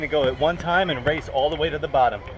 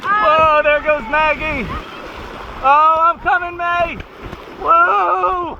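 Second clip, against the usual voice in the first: excited shouting; 11 semitones higher, 6 dB louder, slower than usual. Real, not acted. Racing down the slide.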